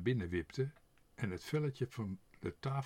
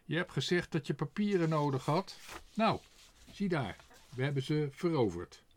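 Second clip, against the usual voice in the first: higher, louder crow voice boasting; proud crow; 5 semitones higher, 5 dB louder than usual. A narrator's reading. Quark proudly shows Tos the papers, raised bird voice. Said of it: "zei Quark plechtig".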